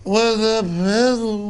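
high, drawn-out mock reverence; mock awe. Host ridiculing those who graced them, mock awe. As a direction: gush slowly in a high voice. Mocking media figures' grand airs.

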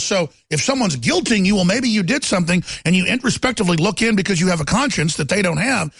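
ordinary talk, nothing marked

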